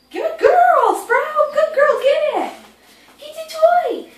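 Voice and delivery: high, loud puppy praise; excited dog praise. Real, not acted.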